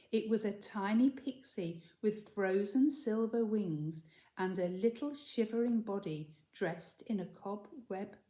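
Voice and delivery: usual speaking voice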